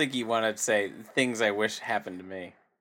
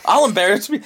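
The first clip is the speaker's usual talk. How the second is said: shouted higher, cartoon bickering; loud cartoon flip; 11 semitones higher, 8 dB louder than usual. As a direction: flip the argument back, loudly. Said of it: "That's like when Bugs Bunny would, like, switch it up".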